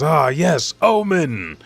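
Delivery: high, loud cry building to the last word; exultant yell. A narrator's reading. He yells as he is restored, exultant. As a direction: yell it out in relish. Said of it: "he yells".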